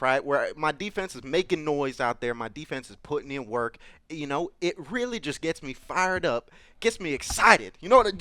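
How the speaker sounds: normal talking voice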